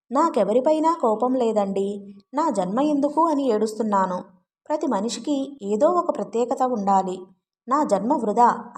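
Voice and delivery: usual speaking voice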